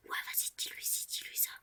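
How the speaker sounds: soft urgent whisper; feed lines quietly behind the phone. Retold or imitated. Cousin whispering instructions.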